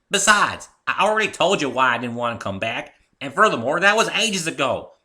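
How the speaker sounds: loud, high and indignant; fighting back at an old friend; heated argument. Acted. Heated quarrel between friends.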